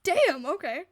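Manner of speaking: loud startled reaction; loud surprise. Real, not acted. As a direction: blurt it out, surprised. Surprised by the count.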